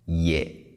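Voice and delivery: "Ye" on a low, level-to-falling tone. Shown as a demonstration. Teacher repeating it for learners, low tone. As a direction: keep "Ye" down at the bottom. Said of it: "the tone is on the lower side".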